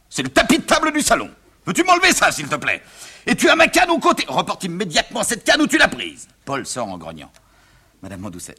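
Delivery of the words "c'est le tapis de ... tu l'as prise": high, loud outraged father; exasperated scolding. Acted. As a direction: order the things put back at once. Father scolds over costume.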